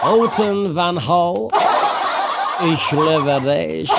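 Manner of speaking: slow, mock German accent; playful hammed-up German accent for the viewers. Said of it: "Lami ka sa German accent".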